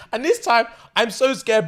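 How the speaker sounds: normal talking voice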